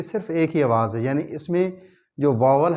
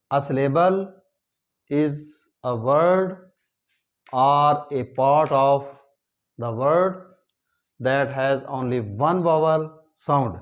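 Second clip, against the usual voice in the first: slow dictation; much slower than usual. Shown as a demonstration. Reads English definition slowly.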